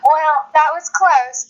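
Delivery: ordinary talk, nothing marked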